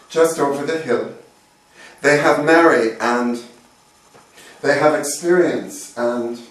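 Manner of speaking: poetry reading. Acted. Poet voices someone with no one left, poetry reading. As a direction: declaim each line, then ease off at the close. Reads own poem aloud.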